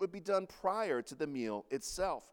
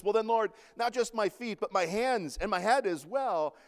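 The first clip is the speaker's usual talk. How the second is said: eager overreaction; 4 semitones higher, 6 dB louder than usual. Retold or imitated. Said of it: "Peter overreacts like usual".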